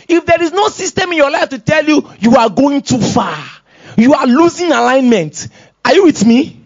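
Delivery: high, loud preacher's urgency; fired-up pulpit delivery; forceful preaching. Real, not acted. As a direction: drive the warning home to the congregation.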